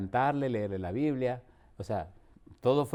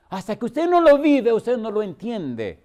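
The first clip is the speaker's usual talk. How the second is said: much higher and louder, then slowing; preaching at full force; 7 semitones higher, 8 dB louder, slower than usual. Real, not acted. Preacher crying out to the church, loud and rising. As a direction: cry it out to the congregation.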